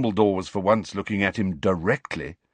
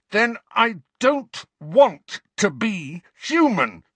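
a slow, much higher and louder roar; 11 semitones higher, 5 dB louder, slower than usual. A narrator's reading. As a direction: roar it at him in despair. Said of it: "Harry roared".

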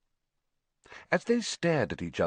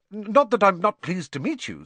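hurried, stammering start, higher and louder; quick; 10 semitones higher, 6 dB louder than usual. A narrator's reading. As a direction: blurt it out to cover a slight. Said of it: "said Harry quickly".

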